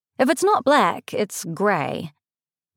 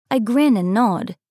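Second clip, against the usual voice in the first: ordinary delivery, nothing marked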